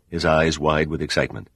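ordinary delivery

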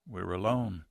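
tender reassurance in the dark. A narrator's reading. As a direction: soothe her gently. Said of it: "he said tenderly".

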